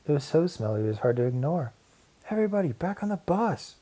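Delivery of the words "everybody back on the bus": shouting. Acted. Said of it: "shouted Wanda".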